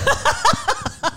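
high breathless laugh; wheezing laugh. Real, not acted. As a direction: laugh until out of air. Wheezing laugh after coughing.

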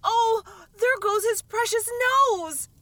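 high, loud frightened shriek; terrified cry. A narrator's reading. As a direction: cry out in terror. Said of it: "in an agony of terror".

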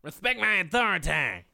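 Cartman. Acted. Asked to be Cartman on a podcast, cartoon impression. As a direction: belt it out in a cartoon character voice. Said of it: "Can you please be Cartman".

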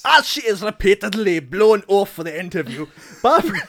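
loud attempt at a Scottish accent; Billy Connolly. Podcaster reading review for co-hosts, exaggerated Scottish accent. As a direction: belt it out in hammed-up Scottish. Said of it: "Billy Connolly impression".

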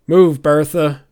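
loud command to a mount; shouted order. A narrator's reading. Sheriff orders his giant bear onward, loud shout. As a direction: bellow to get the beast moving. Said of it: "shouted a familiar voice".

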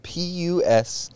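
slow letter by letter spelling. Shown as a demonstration. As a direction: spell it out slowly, one letter at a time. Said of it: "that is spelled".

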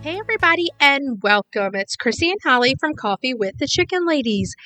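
normal talking voice

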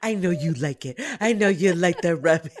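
put-on loud baby talk to a pet, laughing; talking to a dog. Shown as a demonstration. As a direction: coo over the dog being rubbed. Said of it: "talking to Mabel like that".

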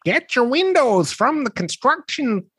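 high, hammed-up Midwest radio ad voice; mock a cheesy local ad read, high. Mocking Wisconsin local radio ads, high hammed-up ad voice. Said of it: "that for me is like a comical voice".

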